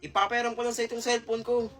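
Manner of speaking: soft offer. Acted. Offering phone gently.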